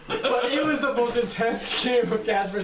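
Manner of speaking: laughing through the words. Real, not acted. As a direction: chuckle as you say it. Laughs while telling meth story.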